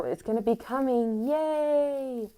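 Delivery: high, drawn-out happy cheer; real excitement. Real, not acted. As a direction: rise into a gleeful, high-pitched yay. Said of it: "super duper duper excited".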